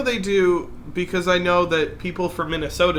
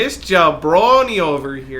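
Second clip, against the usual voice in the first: loud mock-Canadian jeer; exaggerated Canadian accent; 7 dB louder than usual. Friend pointing out the Canadian, loud mock accent. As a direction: tease in a big Canadian accent. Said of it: "actually sounds like this".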